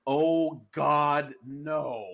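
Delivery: retold horror, drawn out; imitating horrified reaction. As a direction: play someone appalled by a picture. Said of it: "she's like".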